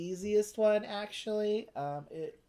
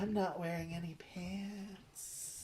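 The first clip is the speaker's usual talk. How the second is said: whispered aside; 10 dB quieter, slower than usual. Pretend. Joking confession to the camera.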